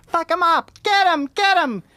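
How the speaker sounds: high mock heckler yelling from the crowd; squeaky mock woman shouting from behind; shrill woman heckler voice. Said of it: "These women voices from behind the crowd".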